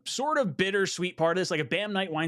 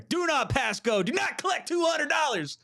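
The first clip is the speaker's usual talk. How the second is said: playful shout; 8 semitones higher than usual. Acted. Fired up, shooing Dolphins fans.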